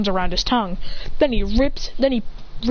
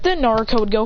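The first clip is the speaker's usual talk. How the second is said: plain reading voice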